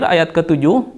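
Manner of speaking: ordinary talk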